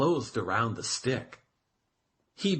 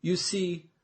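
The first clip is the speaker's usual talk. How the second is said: ordinary delivery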